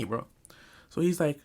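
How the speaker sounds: ordinary talk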